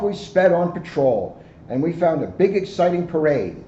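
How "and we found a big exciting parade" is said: plain reading voice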